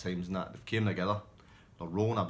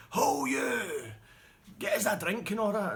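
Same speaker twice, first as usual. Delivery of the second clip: much higher, louder bellow; bar bully shout; 14 semitones higher, 5 dB louder, slower than usual. Retold or imitated. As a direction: bark orders down the bar. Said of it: "he just shouted at people".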